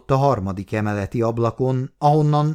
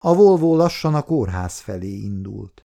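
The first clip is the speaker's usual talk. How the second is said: ordinary delivery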